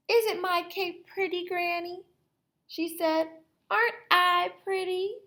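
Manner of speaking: put-on high preening girl voice; vain Little Red. Vain Little Red showing off her cape, high.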